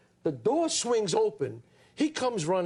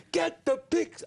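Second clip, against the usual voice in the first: much higher, pushed-up bark of the line; bigger delivery; 10 semitones higher, slower than usual. Retold or imitated. Actor told to go bigger, jumps much higher. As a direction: go bigger, pitch it way up. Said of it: "bigger, bigger".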